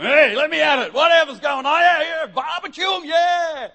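high, loud, shouting hothead begging for action; a loud, high pumped-up young tearaway; eager hothead. Retold or imitated. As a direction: shout it like a rowdy kid itching for a fight. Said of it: "I can see you all that sort of".

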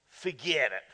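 mock New York "Forget it."; New York accent. Shown as a demonstration. Preacher mimicking a New Yorker, light New York accent. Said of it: "Like a New Yorker".

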